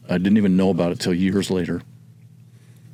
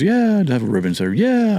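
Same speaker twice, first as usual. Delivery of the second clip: neighbor lady; 11 semitones higher, 3 dB louder than usual. Retold or imitated. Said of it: "She goes".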